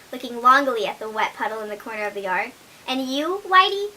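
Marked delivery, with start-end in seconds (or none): 2.8-3.9 a touch of sadness in the question; rather sad voice. A narrator's reading. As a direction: ask sadly, disappointed. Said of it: "in a rather sad voice".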